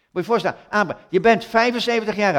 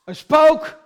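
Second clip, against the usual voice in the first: mock fright; 9 semitones higher, 10 dB louder than usual. Mimicking disciples' fright.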